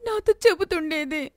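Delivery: a daughter confronting her gambling father; accusing daughter. Acted.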